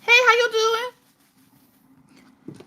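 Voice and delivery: squeaky high-pitched hello to a viewer; high greeting voice. Acted. Streamer greeting a chat viewer, squeaky high voice. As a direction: chirp the viewer's name way up high.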